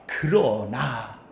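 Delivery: 그러나 said slow, low and soft; 그러나 drawn out and hushed; stress on 그러나. Shown as a demonstration. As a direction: linger on 그러나 quietly. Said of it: "이 말의 앞에 있는 말이 아주 중요합니다".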